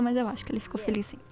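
quick unvoiced whisper. Real, not acted. Admitting to viewers she missed it, whispered. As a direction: let it slip out as a hushed aside. Aside admitting not paying attention.

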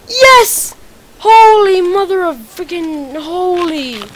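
loud, high, drawn-out cheer; triumphant shout. Real, not acted. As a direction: celebrate the kills out loud. Celebrating a double kill.

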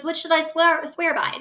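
lightly voiced eager Romeo; Romeo asking.

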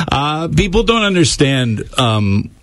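ordinary talk

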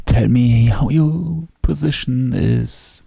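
slow, drawn-out seductive voice; sultry voice. Pretend. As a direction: purr it slowly. Mock sultry English question.

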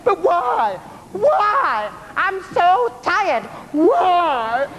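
high, slow tired whine; whining. Acted. Sailor kept on deck whines.

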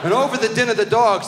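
ordinary delivery

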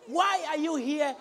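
mock confrontation. Preacher retelling confronting a believer, mock shout. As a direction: call him out in the queue. Challenging man in queue.

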